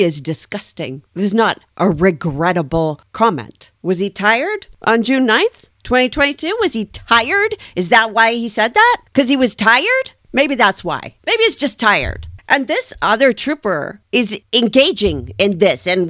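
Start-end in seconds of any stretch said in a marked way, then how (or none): 3.8-12.3 mock wondering in higher, rising pitch; sarcastic questioning. Real, not acted. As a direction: toss out the excuse as high-pitched, incredulous questions. Sarcastically questioning a trooper's excuse.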